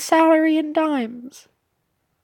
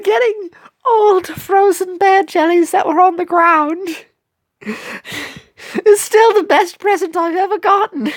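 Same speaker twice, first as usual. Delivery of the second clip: louder, choked-up British character voice; emotional British cookie; 4 dB louder than usual. Acted. Cookie recalls never getting presents, tearful British voice. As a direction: play a poor cookie overcome by a first gift.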